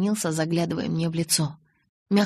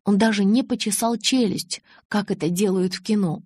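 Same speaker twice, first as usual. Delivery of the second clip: ordinary delivery, nothing marked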